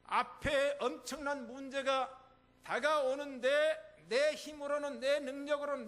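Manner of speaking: slow, building sermon cadence; preacher pressing the congregation, rising; preaching. Acted. Pastor challenging the congregation, slow and building.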